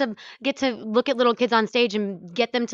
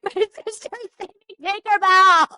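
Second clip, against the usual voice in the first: high, loud, slow tearful cry; a choked-up little sister crying over Tinkerbell; retold sobbing; 10 semitones higher, 4 dB louder, slower than usual. Sister in the audience, crying high and slow. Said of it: "sobbing and being like".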